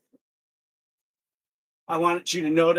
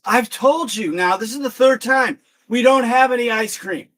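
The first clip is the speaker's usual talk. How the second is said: raised, higher voice of a fed-up clerk; exasperated; 5 semitones higher, 5 dB louder than usual. Acted. Clerk tells a customer the third time, exasperated. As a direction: act out losing patience.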